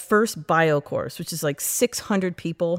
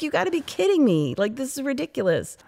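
higher, mock-exasperated retelling; annoyed disbelief; 8 semitones higher than usual. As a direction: replay the fury at the first C, half-joking. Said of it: "super pissed".

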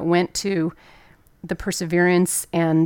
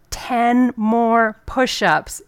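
very slow, higher, louder barked command; yelled order; 5 semitones higher, 5 dB louder, much slower than usual. Retold or imitated. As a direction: shout the order slowly, drill instructor style. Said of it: "he yells out".

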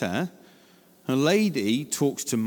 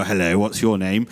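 mock stranger greeting; 7 semitones lower, 5 dB louder than usual. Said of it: "walk up to a child in the street and go".

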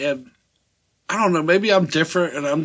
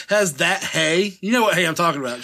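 usual speaking voice

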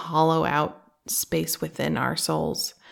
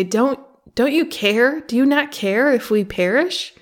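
high, loud, desperate cry over a storm; frightened disciples shouting over the wind; panicked plea; 7 semitones higher, 4 dB louder than usual. Retold or imitated. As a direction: plead like men about to drown. Disciples wake Jesus in storm.

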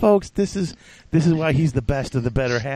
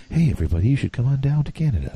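lightly voiced middle school guidance counselor; hint at a counselor addressing everybody; mock guidance counselor; faster than usual. Host imitating his own intro reading voice, mock guidance counselor. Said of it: "like a middle school, uh, you know, uh, g-, guidance counselor".

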